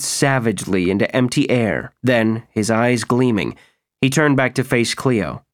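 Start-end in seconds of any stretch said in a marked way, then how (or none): none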